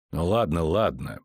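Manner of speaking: low, dismissive cut-in; low-voiced brush-off; interrupting. A narrator's reading. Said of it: "прервал чародей".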